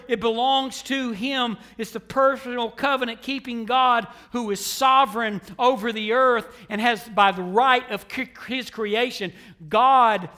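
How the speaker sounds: emphatic sermon delivery; preaching. Real, not acted. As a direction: preach it out loud, punching the key words. Preaching at full force.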